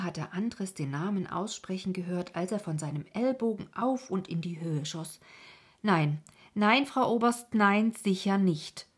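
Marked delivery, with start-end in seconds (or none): 5.8-8.9 loud, raised protest; agitated cry. A narrator's reading. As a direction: cry out in emphatic refusal. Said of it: "rief er und wurde ganz rot vor Anstrengung". (aufgeregt und laut protestierend)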